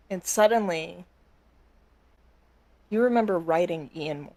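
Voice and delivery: ordinary talk, nothing marked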